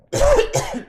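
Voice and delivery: loud sharp dry cough; a sudden cough mid-sentence. Real, not acted. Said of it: "Excuse me".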